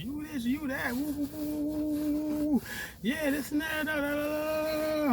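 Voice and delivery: high, soft sing-song mockery; mocking her rant. Girlfriend devaluing him, mocked in high sing-song. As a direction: rattle it off high like meaningless nagging. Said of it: "coming hard into a devaluation".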